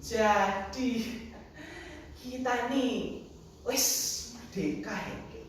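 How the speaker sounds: softening, halting, unsure query; hesitant child's question. Acted. Grandchild seeks grandfather's confirmation, hesitantly. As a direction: ask grandpa tentatively, trailing into a question.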